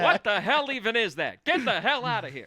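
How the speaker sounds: wysoki, głośny, przeciągnięty okrzyk ze śmiechem; mock outraged voice. Mock dad shouting.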